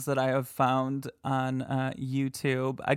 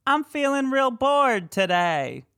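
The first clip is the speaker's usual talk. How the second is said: much higher and louder ghost mimicry; mock EVP voice; 11 semitones higher, 4 dB louder than usual. Host offering a guess to listeners, high mock ghost voice. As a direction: sing out the option like the recording. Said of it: "Or D".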